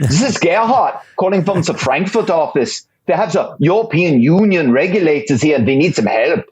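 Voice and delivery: German accent. Shown as a demonstration. Gerhard calling from the Frankfurt office, in a German accent. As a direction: play a German office caller with a thick accent. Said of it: "my, uh, German accent".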